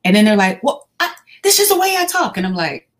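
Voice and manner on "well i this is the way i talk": high-pitched mock protest of a child; imitating yelling child. Child defending their yelling to a school worker, high mock protest. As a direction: play the defensive kid, pitched way up. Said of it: "Why are you yelling at me?".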